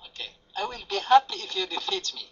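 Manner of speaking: normal talking voice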